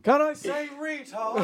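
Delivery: high, slow call from off mic; off microphone. Pretend. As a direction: lean away and pitch it way up, laughing. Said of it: "I'll say it off microphone".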